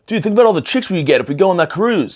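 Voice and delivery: high, eager party bro. Pretend. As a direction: pitch it up like a hyped frat bro. Voicing a cruise-loving bro.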